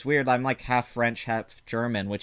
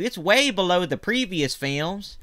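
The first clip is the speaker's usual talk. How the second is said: slow, higher Southern drawl; Southern accent; 8 semitones higher, slower than usual. Real, not acted. Host catching himself going Southern, drawled Southern accent. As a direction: let the Southern twang slip out. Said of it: "about to go all Southern on you".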